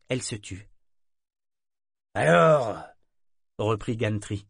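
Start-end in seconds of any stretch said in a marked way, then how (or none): 2.1-2.8 annoyed. Acted. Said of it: "l'agacement perçait dans sa voix".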